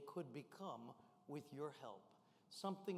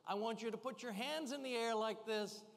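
normal talking voice

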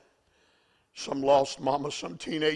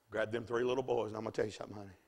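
usual speaking voice

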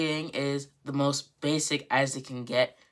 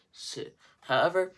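ordinary talk, nothing marked

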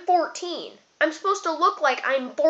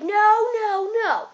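higher-pitched, drawn-out comic protest; mock panic; 8 semitones higher, 3 dB louder, much slower than usual. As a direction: ham up a dread-filled refusal. Acted panic, retold.

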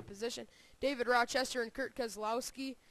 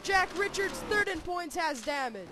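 excited; 6 semitones higher, 5 dB louder than usual. Real, not acted. Big wreck called live.

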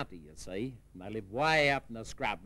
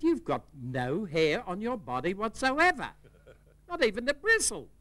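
much higher, louder scandalised gasp of a voice; shocked auntie; 7 semitones higher, 4 dB louder than usual. Acted. Auntie aghast at the bare niece, high shocked voice. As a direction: play the auntie aghast at what she sees.

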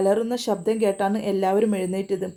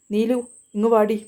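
narrator's restrained angry summons; bursting anger; 3 dB louder than usual. A narrator's reading. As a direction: call her over with a touch of anger. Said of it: "ശിവൻ്റെ ദേഷ്യം പൊട്ടിയൊഴുകുകയാണ്". (നേരിയ ദേഷ്യത്തോടെ വിളിക്കുന്നു)

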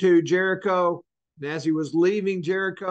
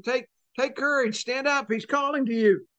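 higher, eager coaxing voice; urging crowd; 7 semitones higher than usual. Acted. Crowd urging the blind beggar to stand up, eager and higher. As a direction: coax the blind man up, higher and lively.

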